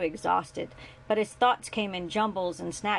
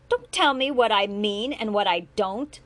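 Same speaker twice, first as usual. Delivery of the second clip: a raised, biting retort; angry retort; 2 semitones higher, 7 dB louder than usual. A narrator's reading. Julian to his father, angry and raised. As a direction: snap it back at him hard. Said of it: "pointing an angry finger at him".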